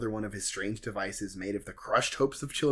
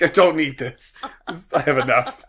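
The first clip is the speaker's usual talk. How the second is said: mock fed-up actor; 3 semitones higher, 10 dB louder than usual. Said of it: "It's like".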